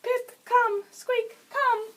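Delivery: high, loud summons to a pet; loud, high come-here call; pet command call. Shown as a demonstration. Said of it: "those are the commands".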